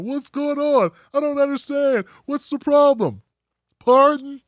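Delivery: high, loud, hammed-up innocent whine; feigned confusion.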